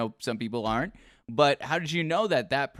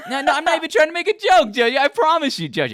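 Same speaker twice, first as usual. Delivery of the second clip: loud, high, rushed insistence; flustered protest; 13 semitones higher, 8 dB louder, faster than usual. Real, not acted. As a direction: protest over laughter, pitch shooting up. Insisting he is not joking.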